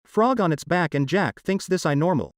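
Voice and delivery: high, bright storyteller voice; story narration. A narrator's reading. Narrator sets the scene.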